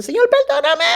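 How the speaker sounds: high, strained mock weeping plea; tearful plea. Repentant musician begging God, imitated sobbing. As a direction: imitate a man crying out for forgiveness. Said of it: "estaba en el Bema llorando".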